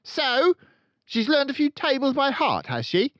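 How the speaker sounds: loud, high barking headmistress; booming tyrant headmistress; barking Trunchbull. A narrator's reading. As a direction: bark it out like a bully. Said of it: "Miss Trunchbull barked".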